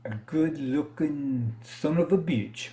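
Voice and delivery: high, slow, hammed-up Spanish accent; exaggerated Spanish accent. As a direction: ham up a Spanish accent like a boastful ballplayer admiring himself. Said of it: "in his exaggerated Spanish accent".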